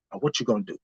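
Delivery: low, soft inner taunt; quiet self-dare, low and hushed. Retold or imitated. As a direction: goad yourself under your breath.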